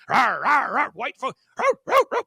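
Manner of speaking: loud hammed-up rawr growls; mock dog growl. Imitating an out-of-control dog, loud mock growling.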